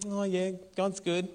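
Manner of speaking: bored, limp "oh yeah"; mock halfhearted praise. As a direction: sound unimpressed on purpose. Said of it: "It doesn't mean whisper".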